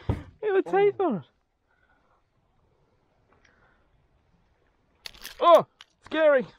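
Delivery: high, loud excited squeal. Real, not acted. Squealing excitedly to a mate about the eel's teeth. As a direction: shriek with glee at the teeth.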